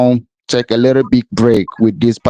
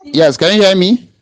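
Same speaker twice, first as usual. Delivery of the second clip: raised, high calling voice; across the street; 7 semitones higher than usual. Real, not acted. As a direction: call out to a faint line. Caller can't hear host.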